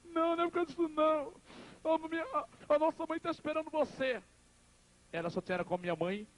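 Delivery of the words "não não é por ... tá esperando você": high, wailing retold plea; imitated tearful brother. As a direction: act out a crying brother breaking bad news. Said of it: "meu irmão chorando".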